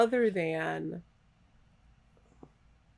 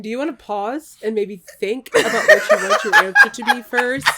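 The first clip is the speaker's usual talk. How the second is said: louder, laughing question; 6 semitones higher, 14 dB louder than usual. Real, not acted. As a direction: talk through laughter. Laughing while asking Jaden.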